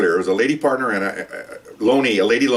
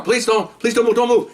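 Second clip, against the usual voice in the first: high, pleading quote; acted urgent plea; 12 semitones higher than usual. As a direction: act out a plea in a much higher voice. Acts out frightened suspects' pleas.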